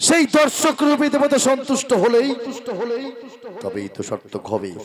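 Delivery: high, loud, long held final words; dramatic drawn-out announcement. Real, not acted. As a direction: stretch the last words like a stage herald. Jatra announcer over loudspeaker.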